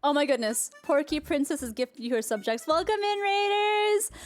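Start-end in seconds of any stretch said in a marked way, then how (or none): 2.6-4.1 excited greeting. Real, not acted. Greeting the raid.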